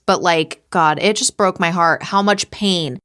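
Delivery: ordinary delivery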